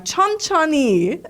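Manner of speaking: high, quick instruction to a class; dance teacher cue. Shown as a demonstration. Teacher giving dancers Korean cues, high brisk call. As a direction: call it out in a lifted voice.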